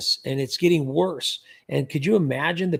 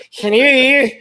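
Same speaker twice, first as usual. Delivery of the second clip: a high, loud mimicking "Hello."; mock scammer hello; 7 semitones higher, 10 dB louder than usual. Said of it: "When they say".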